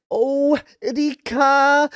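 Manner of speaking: lightly voiced sulky girl, stretching the name; passive-aggressive Hanyu. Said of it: "she's so passive aggressive about it".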